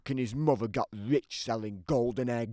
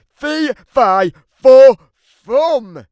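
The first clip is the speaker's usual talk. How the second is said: much higher, very loud roar; furious bellow; 11 semitones higher, 15 dB louder, much slower than usual. Acted. Angry giant calling out for Jack the last time, roaring. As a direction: roar it at full force. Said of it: "I called out".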